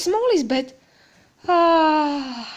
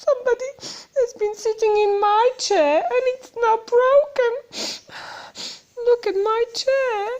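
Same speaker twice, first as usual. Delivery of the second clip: much higher, tearful little bear voice; a small bear upset about his broken chair; high squeaky weepy cub; squeaky Baby Bear; 10 semitones higher than usual. Acted. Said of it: "Baby Bear's high, squeaky voice".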